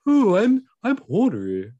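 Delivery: lightly voiced posh toff; a hint of a posh gentleman. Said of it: "sounds like a posh person".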